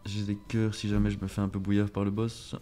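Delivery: usual speaking voice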